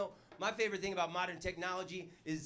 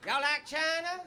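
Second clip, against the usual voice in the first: mock-Texan welcome, pitched up; Texan drawl; 7 semitones higher than usual. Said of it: "Chinese people walking around going".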